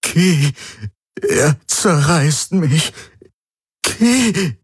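anguished. A narrator's reading. Garlin in agony calling for Kirai, slow anguish. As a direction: cry out being torn apart.

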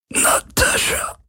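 slow, unvoiced dying whisper; faint final gasp of a name; dying word. A narrator's reading. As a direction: breathe out a last word. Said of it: "Blut kam über seine Lippen und ein Wort".

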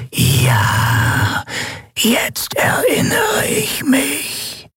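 slow, breathless halting whisper that stumbles; a ruined machine groping for its lost task; whispering broken machine mask. Acted. Maske flüstert, erinnert sich. (stockend flüsternde Maske, verwirrt)

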